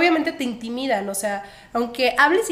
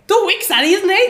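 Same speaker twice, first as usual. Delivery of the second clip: higher, louder, slow disbelief; incredulous officer; 6 semitones higher, 6 dB louder, slower than usual. Retold or imitated. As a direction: ask it like you can't believe it. Agente incrédulo en migración. (agente de migración incrédulo)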